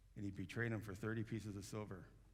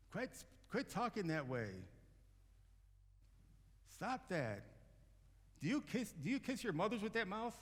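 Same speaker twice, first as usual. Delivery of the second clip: put-on scolding old lady; 11 semitones higher than usual. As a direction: scold the kids like a shocked elderly lady. Elderly woman scolding kids.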